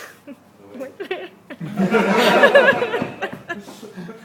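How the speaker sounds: big high burst of laughter; loud laugh. Real, not acted. Loud laugh during reading.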